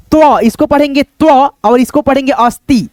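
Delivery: normal talking voice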